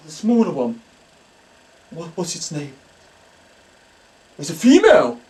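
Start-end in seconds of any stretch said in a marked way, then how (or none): none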